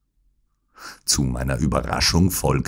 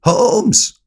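high, loud, insistent shout; louder repeated call; 6 dB louder than usual. A narrator's reading. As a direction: shout the name to wake him. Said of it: "rief er von neuem". (lauter, hoher Ruf)

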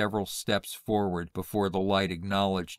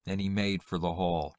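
ordinary delivery

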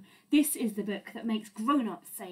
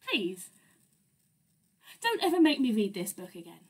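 fast begging; 4 semitones lower, faster than usual. Acted. Reader begs after nonsense.

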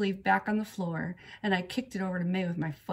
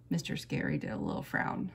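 ordinary delivery

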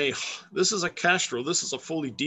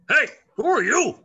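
higher, louder gruff challenge from a hawk; mean hawk voice; 10 semitones higher, 6 dB louder than usual. Pretend. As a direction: play the hawk sizing you up. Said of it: "a hawk looks at you and goes".

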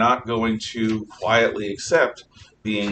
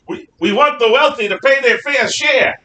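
high, loud mock Brooklyn accent politician; 12 semitones higher, 7 dB louder than usual. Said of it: "In a Brooklyn accent for some reason".

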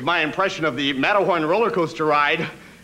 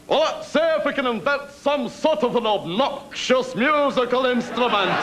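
inventor voice; 8 semitones higher than usual. Retold or imitated. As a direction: play the scheming inventor, pitched up. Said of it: "The guy had to say to himself".

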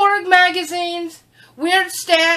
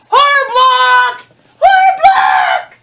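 high, loud, slow excited cry of horror block; gleeful high shout; excited squeal; 9 semitones higher, 8 dB louder, slower than usual. Real, not acted. Excited for the subscription box.

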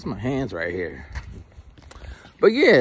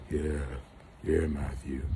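lower, softer, gentle; soothing voice; 7 semitones lower, 8 dB quieter, slower than usual. Shown as a demonstration. Friend agreeing calmly, soft soothing voice. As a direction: soothe him softly. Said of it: "soothing voice".

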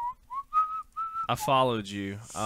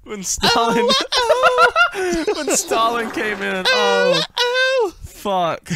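laughing; 13 semitones higher, 11 dB louder than usual. Real, not acted. Streamer cracking up over clip.

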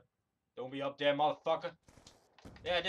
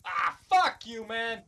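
angry curse; 2 semitones higher, 5 dB louder, slower than usual. Real, not acted. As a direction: stretch out a furious curse. Player rages after being killed.

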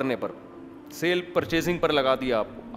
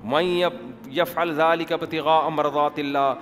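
Arabic verse recited in a chanting tone; Quranic recitation. Shown as a demonstration. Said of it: "قرآن کے الفاظ دیکھو".

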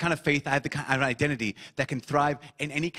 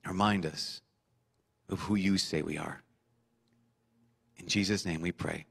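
hushed, humble pastoral prayer; closing prayer; 4 semitones lower than usual. Acted. Leads the congregation in prayer.

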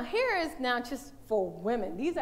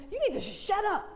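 mock snapping mother, higher; harsh mother; 8 semitones higher than usual. Mother snapping at her one-year-old, imitated harshly. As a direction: bark it at a small child. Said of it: "I don't know if you've heard women".

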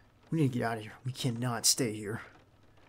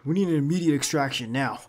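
urgent radio call; 2 semitones higher, 8 dB louder than usual. Acted. Calling for extraction over radio.

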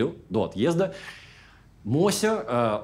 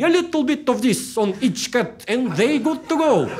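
high, slow, drawn-out doctor's advice; high, slow vet voice; 9 semitones higher, slower than usual. Retold or imitated. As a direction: lift the pitch and slow it right down. Vet's instructions quoted.

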